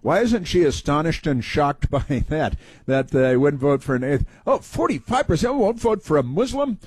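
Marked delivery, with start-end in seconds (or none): 4.5-6.8 mock astonishment. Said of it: "she's astonished".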